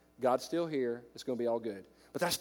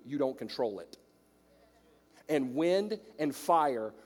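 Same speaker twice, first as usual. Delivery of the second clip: normal talking voice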